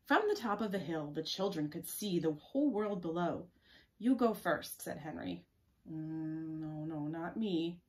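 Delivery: expressive picture-book narration; story read-aloud. Acted. Reading the hilltop scene to children, expressive storytelling. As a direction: read it out clearly for young listeners.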